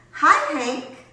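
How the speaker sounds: high, loud friendly hail; calling out. A narrator's reading. Rosie the tumbleweed greets Hank, calling out. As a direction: sing out a greeting across the way. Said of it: "Rosie the tumbleweed called out".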